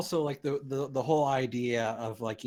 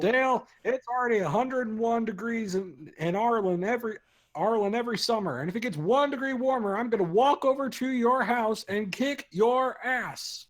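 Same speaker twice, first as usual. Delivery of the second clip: Hank Hill voice; 11 semitones higher, 5 dB louder than usual. Retold or imitated. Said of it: "that was so good".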